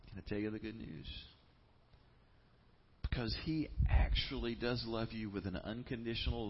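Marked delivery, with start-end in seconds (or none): none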